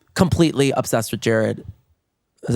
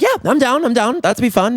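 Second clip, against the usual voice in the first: high, eager, bouncing agreement; eager yes; 10 semitones higher than usual. Retold or imitated. Husband agreeing to a threesome, high and eager. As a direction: jump at the offer.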